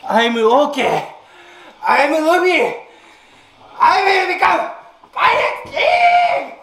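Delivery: high, strained screaming through burning mouth; pained shouting. Real, not acted. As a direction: yell it out in fiery pain. Spicy chip pain, shouting One Piece lines.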